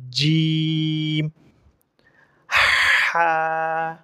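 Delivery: each letter from "আলিফ" to "দদ" drawn out on a level note; Arabic letter recitation. Shown as a demonstration. Teacher repeats the alphabet for practice, slow drawn-out letters. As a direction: recite the letters one by one, long and careful, pausing between. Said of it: "এভাবে উচ্চারণ করতে হবে".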